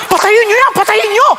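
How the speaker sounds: high, loud mock shout to kill the TV; yelling in fake horror at own face on TV. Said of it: "Sabi ko". (pasigaw na kunwaring takot)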